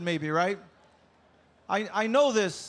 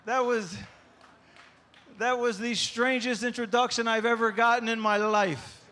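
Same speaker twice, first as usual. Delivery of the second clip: normal talking voice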